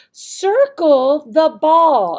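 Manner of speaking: slow, loud, sing-song; teacher voice. Shown as a demonstration. Teacher modeling the task, slow and sing-song. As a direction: coax the student along. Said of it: "showing them what you want".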